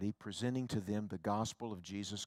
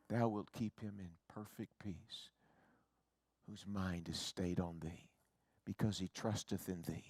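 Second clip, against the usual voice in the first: scripture reading; 4 semitones lower, 8 dB quieter than usual. Acted. Recites scripture softly.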